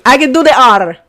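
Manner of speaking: the "R" ends in a short tap of the tongue; rolled R. Shown as a demonstration. Insisting to viewers you can do the "R", short rolled ending. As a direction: flick the final "R" Spanish-style.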